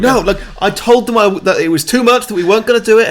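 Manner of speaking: high, loud, flustered pleading; mock protest. Vampire objecting to the procession of shame, mock protest. Acting out the argument in film.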